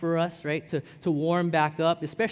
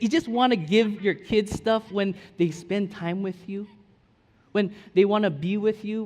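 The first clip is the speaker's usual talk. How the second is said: normal talking voice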